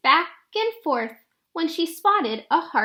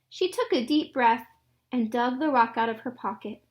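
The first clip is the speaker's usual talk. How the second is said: ordinary delivery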